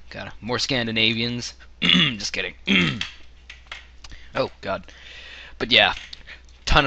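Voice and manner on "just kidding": ordinary talk, nothing marked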